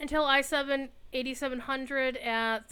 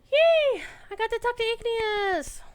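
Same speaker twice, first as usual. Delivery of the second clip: squealed yay, much higher and louder; real happy squeal of joy; excited cheer; 11 semitones higher, 12 dB louder than usual. Real, not acted. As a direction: burst out with delight. Cheering about talking to a friend.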